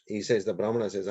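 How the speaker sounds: ordinary delivery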